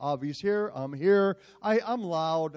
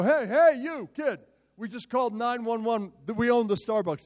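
retold shout; 6 semitones higher than usual. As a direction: yell across the room at the kid. Businessmen run over shouting.

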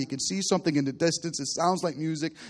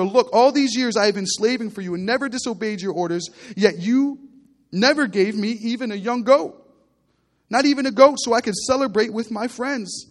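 resentful complaint; 6 semitones higher, 4 dB louder than usual. Acted. Older son complains to father.

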